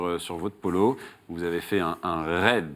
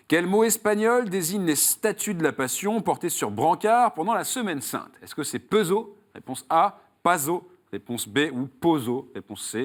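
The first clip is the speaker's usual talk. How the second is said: raised, emphatic game-show host voice, settling on the last option; quiz question read; 6 semitones higher than usual. Acted. Host quizzes a contestant on Saul's road, quiz question read. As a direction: pose the question and lay out each choice.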